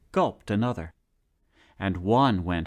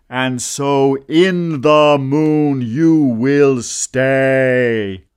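loud, slow, commanding voice; booming scolding ruler; 2 semitones higher, 10 dB louder, much slower than usual. Acted. Storyteller voicing a stern ruler, loud and slow. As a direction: banish him grandly from the throne.

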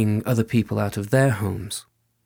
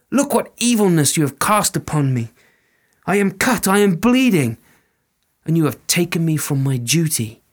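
higher, louder scolding cry; bleeding woman scolding; 6 semitones higher, 4 dB louder than usual. Acted. Fairy woman rebukes the soldier, high and louder. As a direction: accuse him of the wound. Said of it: "she said".

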